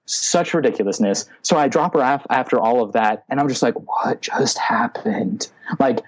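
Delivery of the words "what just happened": slow, whispery, drawn-out bewilderment; stunned disbelief. Retold or imitated. Replaying his reaction after dropping her off, stunned disbelief. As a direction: draw each word out in breathy disbelief.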